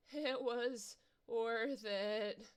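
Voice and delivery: very slow, drawn-out last words; dying worm. Acted. Slain bandage worm gives its last words, very slowly. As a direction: let the words drag out slowly as it dies.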